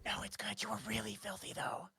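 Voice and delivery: soft, awkward murmur; hushed reassurance. Acted. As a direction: say it under your breath, gently excusing them.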